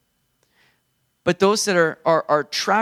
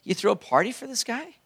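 higher-pitched, mock aggrieved complaint; whiny older brother; 5 semitones higher than usual. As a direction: play the jealous son whining to his father, a few tones up. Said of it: "He's like".

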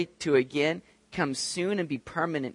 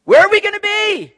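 eager demand; 13 dB louder than usual. Retold or imitated. Disciples demand their seats.

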